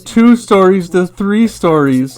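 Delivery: sarcastic awe. Acted. Streamer ridiculing gentle density housing, hammed-up sarcastic awe. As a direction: ham up being amazed to ridicule it.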